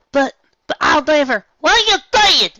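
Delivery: very high, loud broken cry; panicked protest. Acted. Applejack pleading with her family, high loud cry. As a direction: cry out as she's struck.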